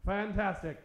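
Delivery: ordinary talk, nothing marked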